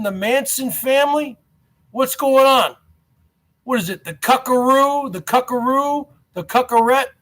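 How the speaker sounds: usual speaking voice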